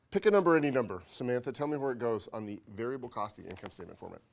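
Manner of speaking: ordinary delivery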